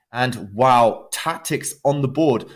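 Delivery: excited commentary. Real, not acted. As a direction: call the tactic with thrilled surprise. Sudden tactic in live commentary.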